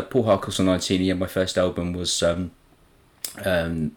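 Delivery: ordinary delivery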